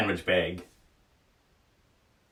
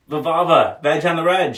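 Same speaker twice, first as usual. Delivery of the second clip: higher, louder call-out greeting; excited greeting; 7 semitones higher, 5 dB louder than usual. Real, not acted. Greeting a regular listener, excited call-out. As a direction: hail the listener with a catchphrase.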